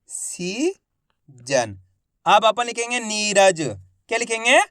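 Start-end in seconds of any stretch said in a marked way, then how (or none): 0.1-1.8 soft low drawn reveal. Shown as a demonstration. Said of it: "तो मिलके बन जाएगा".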